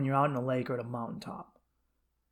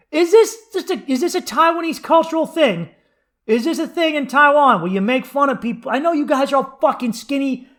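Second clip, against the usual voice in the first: high, loud, indignant ranting; exasperated rant; 10 semitones higher, 9 dB louder than usual. Retold or imitated. Answering the girl who mocked his belly, high loud outrage. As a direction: vent your outrage at her, pitched up and loud.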